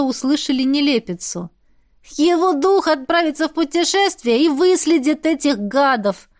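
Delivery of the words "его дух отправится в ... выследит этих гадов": put-on excited child. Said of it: "фыркнул Бар".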